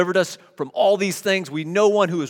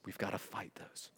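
whispered; 10 semitones lower, 21 dB quieter than usual. Real, not acted. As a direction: whisper "We've gotta fight those" almost unvoiced. Whispered, then repeated loud.

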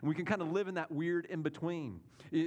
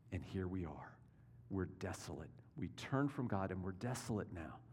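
quiet lament; 7 semitones lower, 6 dB quieter than usual. Retold or imitated. Daniel's lament, voiced by preacher.

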